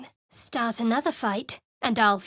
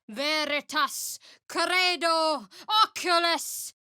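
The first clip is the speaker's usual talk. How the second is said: slow, higher measured chant; spell incantation; 6 semitones higher, slower than usual. Acted. Cleric chanting a divine spell, measured rite. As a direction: lay out each word as a rite.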